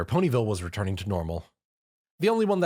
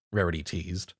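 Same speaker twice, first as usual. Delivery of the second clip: ordinary delivery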